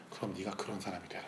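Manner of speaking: very soft, tender voice of God; loving voice. Retold or imitated. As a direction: hush it right down, a loving call. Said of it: "사랑의 음성을 들렸어요". (나직한 사랑의 음성)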